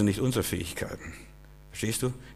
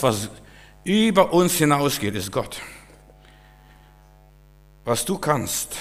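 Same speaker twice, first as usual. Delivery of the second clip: ordinary delivery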